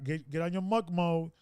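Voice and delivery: ordinary talk, nothing marked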